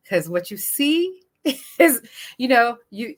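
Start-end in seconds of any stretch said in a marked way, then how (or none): none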